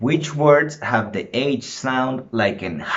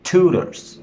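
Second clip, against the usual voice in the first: "Tutors" with a higher first syllable; stress on first syllable; 7 semitones higher, 4 dB louder than usual. Shown as a demonstration. Teacher asking class for the strong syllable, stressed TU in "Tutors". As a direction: hit the TU in "Tutors". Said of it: "the strong syllable on tutors".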